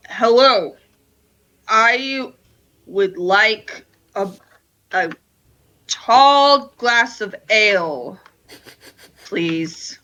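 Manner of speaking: loud, slow attempt at a deep voice; trying to sound deep. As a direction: fake a tough voice to order ale. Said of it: "trying to sound deep".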